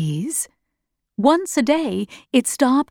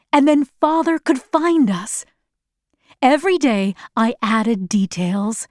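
plain reading voice

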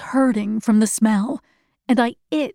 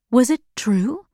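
ordinary delivery

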